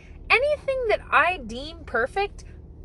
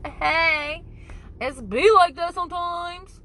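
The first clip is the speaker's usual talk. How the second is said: sassy quip; 4 semitones higher, slower than usual. Pretend. Mock sassy catchphrase.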